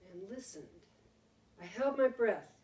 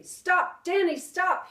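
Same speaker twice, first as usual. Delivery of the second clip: high loud urgent stop; a father warning his son off the pit; shouting a warning; 9 semitones higher, 10 dB louder, slower than usual. Acted. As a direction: bark a warning to halt. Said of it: "he shouted".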